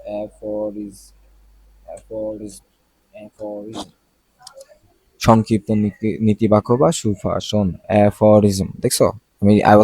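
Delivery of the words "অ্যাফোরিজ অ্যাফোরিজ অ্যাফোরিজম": quiet, distant playback of a word; dictionary audio playing. Real, not acted. Teacher checks a pronunciation. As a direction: let the pronunciation play softly in the background. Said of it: "উচ্চারণটা দেখে নিই".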